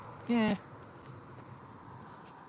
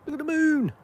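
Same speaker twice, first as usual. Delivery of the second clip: delighted high cutesy voice; 8 dB louder than usual. Real, not acted. To his viewers about the moon, a high cooing baby voice. Spots the moon while streaming.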